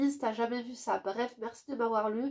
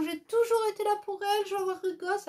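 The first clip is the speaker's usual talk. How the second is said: much higher, fast whiny mimicry of the ex; mock his tearful plea to the judge, pitched way up; mocking the crying ex; 9 semitones higher, faster than usual. Mocking the ex's tearful plea to the judge, high and whiny. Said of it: "il pleurait devant la juge en disant".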